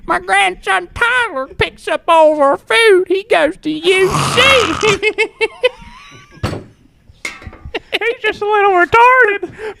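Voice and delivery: put-on very high, frail old man voice; grandpa voice. Grandpa voice scamming a restaurant for food. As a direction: sound like an eighty-three-year-old. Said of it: "in a grandpa voice".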